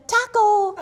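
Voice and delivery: high, loud, laughing "Tackle!"; kid's yell. Retold or imitated. Mother imitating her son's tackle cry, high, loud and laughing. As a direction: shout it through laughter like a gleeful toddler. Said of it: "And he said".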